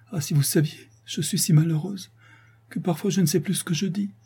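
pleading for forgiveness, mild lament; a hint of a plaintive girl. A narrator's reading. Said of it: "reprend d'une voix plaintive".

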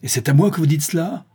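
crying out. A narrator's reading. Jean reacts to news of her fiancé, crying out. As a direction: exclaim in pained disbelief. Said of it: "s'écria Jean".

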